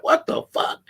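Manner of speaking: whispered high shock; shocked what the fuck. Retold or imitated. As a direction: gasp it out in disbelief. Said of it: "I'm like".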